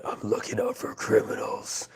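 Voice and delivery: hoarse, voiceless superhero rasp; Batman. Pretend. Joking that a viewer will police the chat, in a raspy Batman voice. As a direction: rasp it out as a crime-fighting vigilante. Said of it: "you'll be the Batman of our community".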